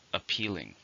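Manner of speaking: Appealing, with the weight on "peal"; stress on the second syllable. Shown as a demonstration. Teacher modelling the word for learners, stress on "peal". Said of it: "stress on the second".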